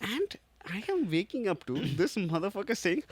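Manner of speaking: normal talking voice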